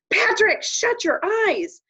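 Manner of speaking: sudden high yell of warning; cowboy barking a warning to Patrick; shouted warning. Acted. Cowboy yelling at Patrick to shut his eyes.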